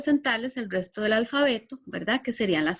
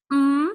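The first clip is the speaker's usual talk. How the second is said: a held, humming mm; M sound; 3 semitones higher, 6 dB louder than usual. Shown as a demonstration. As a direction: hum the M so the children hear it. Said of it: "que suena".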